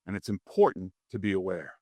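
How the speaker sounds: ordinary talk, nothing marked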